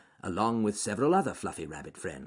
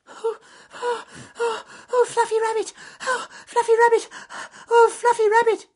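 very high, loud, panicked squeaks; gasping mouse; 11 dB louder than usual. A narrator's reading. Francis Field Mouse bursting in to warn the rabbits, high panicked gasps. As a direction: gasp it out, terrified and breathless. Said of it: "he gasped".

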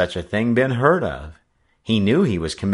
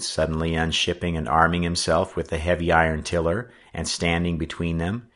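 ordinary delivery, nothing marked

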